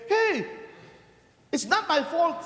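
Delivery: whiny excuse. Retold or imitated. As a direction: play the defensive sinner. Penitent making excuses.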